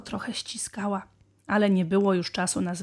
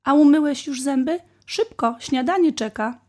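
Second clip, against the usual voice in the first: hurrying mother; 6 semitones higher, 7 dB louder than usual. A narrator's reading. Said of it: "powiedziała mama".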